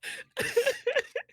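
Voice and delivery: high, wet laugh through tears; teary laugh. Real, not acted. Streamer laughing at her crying chat, teary laugh. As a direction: laugh while still crying. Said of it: "you got the chat crying".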